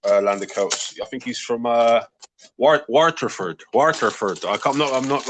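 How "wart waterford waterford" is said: slow, careful try at "Waterford"; attempted pronunciation. Shown as a demonstration. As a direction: sound out "Waterford" haltingly, unsure of it. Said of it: "not gonna be able to say it properly".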